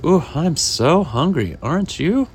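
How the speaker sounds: lightly acted storybook hunger; hungry customer. Woman waiting with her chicken friend, lightly acted hunger.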